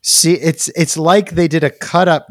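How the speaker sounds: loud, high rant verging on laughter; amused exasperation. Real, not acted. Host rants about the baffling movie, loud and exasperated. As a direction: rail at it, half laughing.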